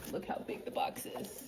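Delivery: awed whisper. Real, not acted. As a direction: breathe it out in wonder. Whispering in awe at gift.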